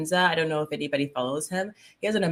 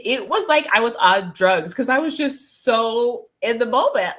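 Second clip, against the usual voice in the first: ordinary talk, nothing marked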